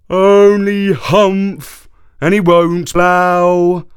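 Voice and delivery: slow, loud, high ox voice. Acted. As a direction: drag it out like a plodding ox. Ox voice in the story.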